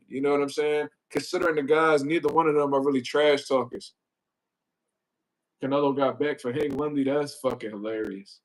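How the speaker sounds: ordinary talk, nothing marked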